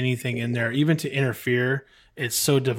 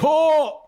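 pained oh; 6 dB louder than usual. Retold or imitated. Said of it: "it's like".

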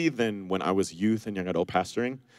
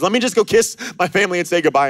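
quick, higher, louder brush-off; hasty excuse; 8 semitones higher, 6 dB louder, faster than usual. Retold or imitated. Would-be follower asking Jesus for delay, quick mock excuse. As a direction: rush the excuse out lightly. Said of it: "This guy says".

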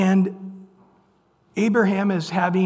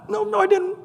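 very high squeaky protest; mock denial; faster than usual. Sarah denies laughing in a mock high protest. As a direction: play Sarah protesting, voice jumped way up. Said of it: "teasing her".

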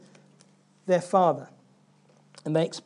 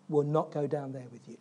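defiant refusal; 7 semitones lower, 9 dB quieter than usual. Retold or imitated. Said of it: "There's this defiance".

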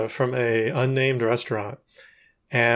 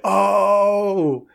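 long, higher "oh" of realization; dawning realization; 7 semitones higher than usual. Retold or imitated. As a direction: act out the penny dropping, drawn out. Clerk realizes his mistake.

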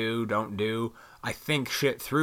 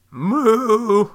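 a loud, high, playful cow moo; cartoonish moo tossed in after the punchline; mock cow moo; 10 semitones higher, 9 dB louder than usual. Mocking cows coming home.

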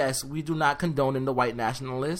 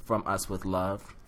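mock sign-off; 5 semitones lower than usual. Host closes a rant with the show's name, mock announcer sign-off.

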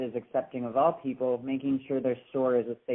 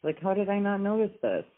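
surprised; 7 semitones higher than usual. Retold or imitated. Retells her own surprise.

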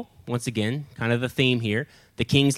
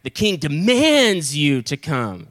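slow, overblown stern command; more serious demand; 3 dB louder, slower than usual. Acted. Next captain trying to outdo the first, exaggeratedly stern. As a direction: hammer and stretch DEMANDS, mock-imperious. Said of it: "we gotta be more serious".

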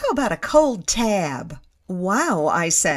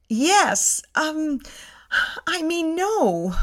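faint, higher-pitched and halting; dizzy; 8 semitones higher, much slower than usual. A narrator's reading. Narrator answering Sandy while about to faint, dizzy. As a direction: waver as if about to pass out. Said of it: "I say, dizzy".

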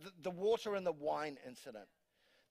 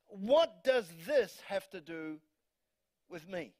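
slow scripture read; slower than usual. Retold or imitated.

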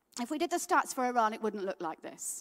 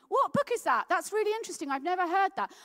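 curious passengers; 7 semitones higher, 4 dB louder, faster than usual. Retold or imitated. Bus passengers ask about book.

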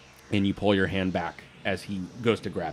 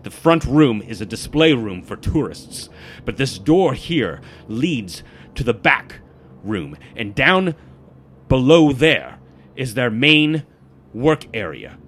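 loud, slow, punched-out briefing; aggressive; 4 semitones higher, 7 dB louder than usual. Acted. Guides the party through the carved map, aggressive. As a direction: drive each point home like a knife stroke. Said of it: "carving into this table really aggressively".

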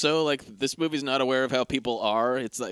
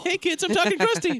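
very high squeaky doll voice; talking toy voice. Retold or imitated. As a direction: pipe it up like a cartoon toy. Quoting the talking Krusty doll.

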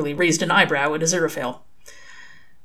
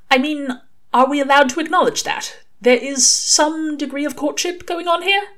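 high, tentative questioning angel; Aziraphale, hesitant; 10 semitones higher than usual. A narrator's reading. Aziraphale asks Crowley about their courtship, hesitant. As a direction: venture the question in a much higher voice.

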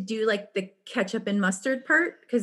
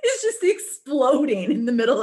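ordinary delivery

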